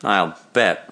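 plain reading voice

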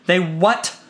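cold, sharp question; icy. A narrator's reading. Wife to husband about the children leaving, icy and sharp. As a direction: freeze him out with two words. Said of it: "she asked icily".

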